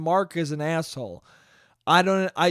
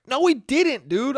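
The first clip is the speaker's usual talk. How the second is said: exasperated; 7 semitones higher than usual. Real, not acted. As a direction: pitch jumps high in disbelief. Arguing with the footage.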